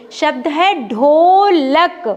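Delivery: ढोलक said slowly and clearly; slow announcing. Shown as a demonstration. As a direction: present the word ढोलक slowly. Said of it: "शब्द को ऐसे पकड़ कर रखेंगे".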